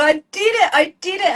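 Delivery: high, loud joyful cry; elated victory shout; triumphant. Retold or imitated. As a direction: relive hugging your client in triumph. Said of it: "I hugged and I go".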